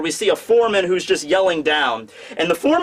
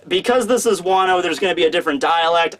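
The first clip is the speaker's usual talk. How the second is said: ordinary talk, nothing marked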